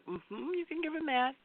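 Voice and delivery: high, soft, permissive reply; imitating approving friend. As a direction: lightly voice a friend giving the okay. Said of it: "Sonia will be like".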